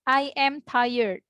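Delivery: very slow teacher demo of "I am tired"; drawn-out classroom example; slow model sentence. Shown as a demonstration. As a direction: stretch each word for learners. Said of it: "teacher models sentence".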